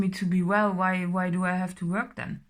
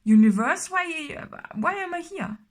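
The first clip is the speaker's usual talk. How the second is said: higher, pleading outburst at the universe; voicing someone fed up with life, higher and louder; exasperated quote; 7 semitones higher, 4 dB louder than usual. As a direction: cry out in frustration at being stuck. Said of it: "And you're like".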